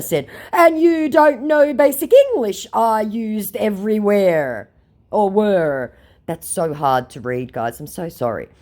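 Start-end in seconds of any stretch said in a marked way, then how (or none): 0.5-5.9 higher, slow scammer; mock scammer voice. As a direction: read it like the amused scammer. Voicing the scammer.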